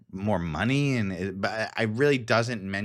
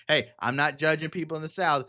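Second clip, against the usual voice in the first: normal talking voice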